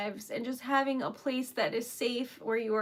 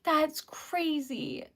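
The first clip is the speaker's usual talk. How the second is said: ordinary talk, nothing marked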